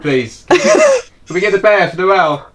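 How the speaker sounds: squeaky high plea. Pretend. Pleading for the bear as a joke.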